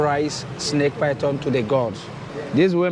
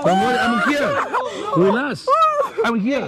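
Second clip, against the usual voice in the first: laughing reassurance; 12 semitones higher than usual. Real, not acted. Calming frightened visitor.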